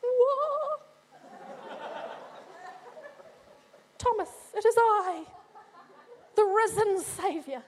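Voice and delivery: preacher playing Jesus surprising Thomas; playful Jesus. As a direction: pop up with a teasing whoa. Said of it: "If I were Jesus".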